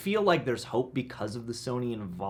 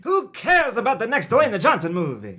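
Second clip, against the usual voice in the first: put-on Swedish accent, a bit raised; 10 semitones higher, 6 dB louder than usual. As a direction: Swedish accent, pleading for the Bond film. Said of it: "Do your best Swedish accent".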